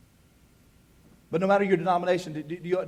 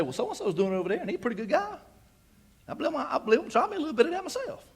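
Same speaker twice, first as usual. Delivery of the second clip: higher, softer voice of talking oneself into it; lightly mock a man making excuses, high and soft; self-justifying; 7 semitones higher, 7 dB quieter, faster than usual. Churchgoer excusing himself in his mind, self-justifying. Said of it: "I will say".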